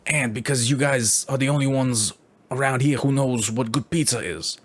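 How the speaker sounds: New York Italian stranger pitching the secret highway to tourists; New York Italian accent. Retold or imitated. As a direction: sell it like a New York Italian con man. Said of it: "He's like".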